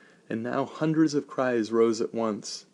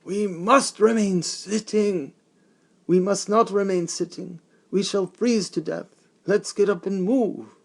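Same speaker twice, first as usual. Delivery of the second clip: high, loud call to the wagon; rousing the freezing crowd; yelled; 10 semitones higher, 5 dB louder than usual. Acted. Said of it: "Somebody got up and yelled".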